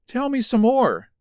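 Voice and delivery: pitched high, pressing him to go on; eager urging. A narrator's reading. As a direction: lean in and coax him to talk. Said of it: "she urged".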